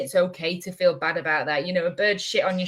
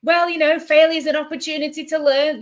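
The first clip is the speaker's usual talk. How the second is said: high, loud preachy mimicry; mock platitude; 8 semitones higher, 5 dB louder than usual. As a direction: send up a smug motivational line, pitched up. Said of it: "you don't always need to be going".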